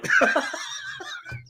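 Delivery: high, wheezing laugh; real laugh. Real, not acted. Fan laughing at his own story, helpless laugh. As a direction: crack up until it squeaks.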